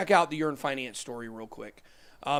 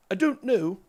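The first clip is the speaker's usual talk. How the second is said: jokey British accent, pitched up and louder; 5 semitones higher, 4 dB louder than usual. Real, not acted. Host joking about London opening bell, mock British accent. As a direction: put on a British voice, higher and punched. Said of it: "that's my British accent".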